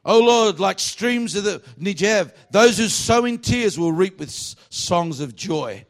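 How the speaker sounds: high, slow, ringing declamation; scripture proclamation. Acted. Preacher reading the psalm aloud to the congregation, slow proclamation. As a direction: read the verse out slowly with force, settling at the end.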